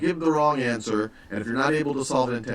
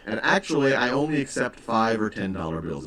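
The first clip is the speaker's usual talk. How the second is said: ordinary talk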